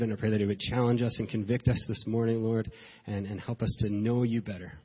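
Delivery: normal talking voice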